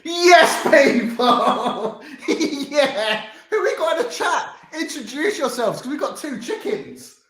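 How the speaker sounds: high, loud, laughing excitement; excited welcome. Real, not acted. Streamer discovering two chicken guests backstage, high excited welcome.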